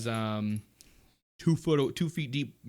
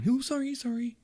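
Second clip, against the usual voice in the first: high little voice, reined-in apology; hushed apology; 13 semitones higher than usual. Acted. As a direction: squeak out an apology after being shushed. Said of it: "Adrastia quiets it down".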